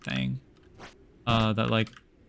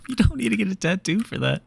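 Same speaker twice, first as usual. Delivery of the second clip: laughing; 8 semitones higher, faster than usual. Real, not acted. Laughing at own joke.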